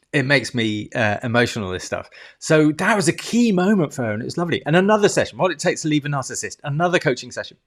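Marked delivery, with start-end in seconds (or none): none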